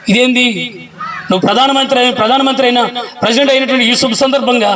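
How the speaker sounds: indignant friend. Retold or imitated. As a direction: push him, amazed he won't join in. Friend scolding Lincoln at party. (ఆశ్చర్యంతో నిలదీసే స్నేహితుడు)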